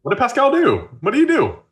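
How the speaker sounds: high, loud surprised question. Real, not acted. Cohost reacting to a Pascal story, surprised and eager. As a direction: jump up in pitch, eager to hear the gossip.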